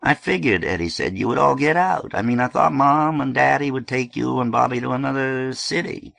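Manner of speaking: a hint of Eddie explaining himself to June; Eddie's voice. A narrator's reading. Eddie to his sister, lightly voiced.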